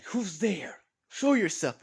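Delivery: nervous loud challenge into darkness; calling out. Acted. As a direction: demand the intruder show himself. Homeowner challenges glowing eyes.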